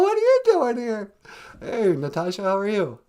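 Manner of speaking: ordinary talk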